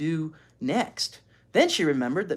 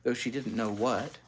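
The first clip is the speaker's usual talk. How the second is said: plain reading voice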